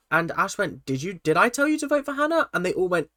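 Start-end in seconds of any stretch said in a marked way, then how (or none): 0.9-2.5 higher, disbelieving question, retold; incredulous challenge. Ash demanding the group confirm her claim, higher and disbelieving. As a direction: challenge them all at once, pitched up.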